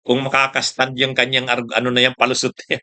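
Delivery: ordinary delivery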